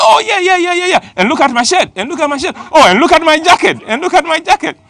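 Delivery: puffed-up man bragging eagerly; boastful excitement. Retold or imitated. Big man boasting about his clothes.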